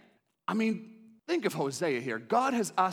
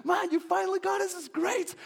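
excited friends; 13 semitones higher than usual. Acted. Friends congratulate Hosea, high and cheering. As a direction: cheer him on wildly. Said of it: "his friends are excited".

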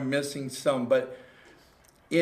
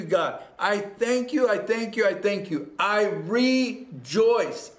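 raised, fervent preacher voice urging everyone to join in; preaching at full force; 4 semitones higher than usual. Acted. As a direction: lead the flock in thanks, pitched up and ringing. Preaching, leading the congregation.

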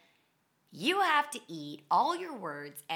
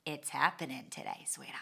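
much lower, darker, fading to a whisper; ominous low aside; 7 semitones lower, 5 dB quieter than usual. Retold or imitated. Warning Satan it's happening, low and menacing. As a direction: drop deep and menacing.

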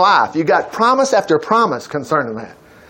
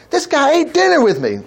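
mock indignation; 9 semitones higher than usual. Acting out David's indignant complaint.

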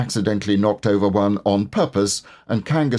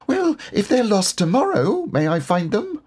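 put-on much higher, hopeful child voice; eager little Roo; 13 semitones higher than usual. A narrator's reading. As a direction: Roo still hoping to go.

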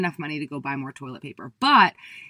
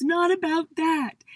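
upset insisting; 10 semitones higher, slower than usual. Retold or imitated. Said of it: "I kept saying to him".

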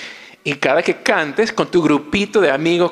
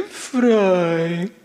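sad; 5 semitones higher than usual. Shown as a demonstration. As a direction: let "Fray" sag, sounding sorry. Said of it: "O si estás triste". ("Fray" triste y suave)

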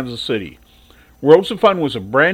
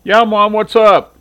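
louder, higher reply down the stairs; calling back; 8 semitones higher, 8 dB louder, slower than usual. Acted. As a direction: answer from bed, projecting.

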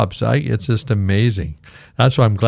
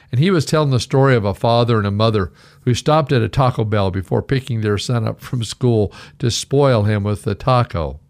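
ordinary talk

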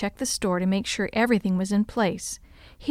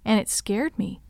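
ordinary delivery

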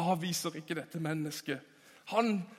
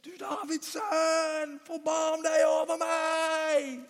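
higher, slow shouted plea; desperate shout; 7 semitones higher, slower than usual. Acted. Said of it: "Han ropte". (fortvilet rop)

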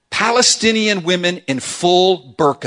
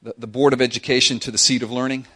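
usual speaking voice

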